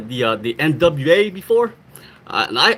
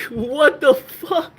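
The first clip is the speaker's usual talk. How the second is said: laughing disbelief; 9 semitones higher, much slower than usual. Retold or imitated.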